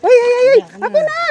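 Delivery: sudden high alarmed cries; startled yelps. Real, not acted. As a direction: yelp in surprise. Startled while climbing.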